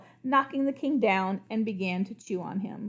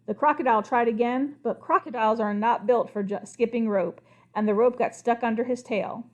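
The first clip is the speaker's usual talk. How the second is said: plain reading voice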